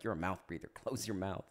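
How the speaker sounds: low, quiet deadpan scolding; not laughing. Retold or imitated. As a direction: imitate an unamused boss, softly. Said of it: "he's not laughing".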